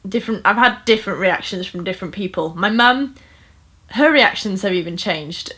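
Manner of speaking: ordinary delivery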